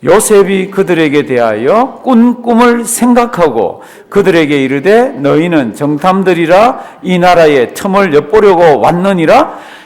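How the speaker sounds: slow, loud, measured reading of the verse; slow scripture reading. Shown as a demonstration. Preacher leading a slow congregational Bible reading. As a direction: read it aloud slowly with the congregation. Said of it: "천천히 한번 읽으시겠습니다".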